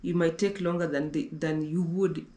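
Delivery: ordinary talk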